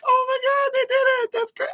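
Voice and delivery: high, thrilled squeal; excited squeal. Retold or imitated. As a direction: squeal with delight at the news. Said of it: "I was like".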